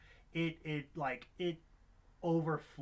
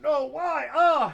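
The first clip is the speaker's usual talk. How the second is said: high, loud pained wail; anguished cry; 12 semitones higher, 16 dB louder, much slower than usual. Retold or imitated. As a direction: cry out in mock anguish at the wound. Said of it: "I'm just like".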